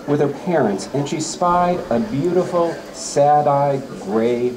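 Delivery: lively storytelling read-aloud; story poem reading. Acted. Teacher reading a poem about a girl and pony, storytelling. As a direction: tell it like a story for children.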